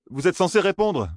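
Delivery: lightly voiced young man, pressing for an answer; a hint of a young man demanding a reply; insisting young man. A narrator's reading. Young Matt insisting to the silent strangers, lightly voiced. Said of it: "insista le jeune homme".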